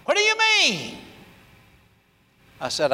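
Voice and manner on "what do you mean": high, sharp mock outrage; indignant what. Student offended by the preacher's disagreement, high indignant outburst. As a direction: squeal the word in offended disbelief. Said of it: "fire almost came out his eyes".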